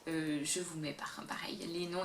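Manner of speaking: murmurer vite, pour soi, en passant; chuchoté en aparté. Real, not acted. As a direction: glisser la remarque tout bas, sans voix. Aparté chuchoté sur les noms. (chuchotement d'aparté, à peine audible)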